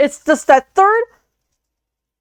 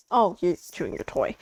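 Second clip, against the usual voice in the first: much softer, lower, tender murmur; soft to puppy; 6 semitones lower, 10 dB quieter than usual. Real, not acted. Host tells her puppy it's fine, soft and tender. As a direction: gently soothe the dog.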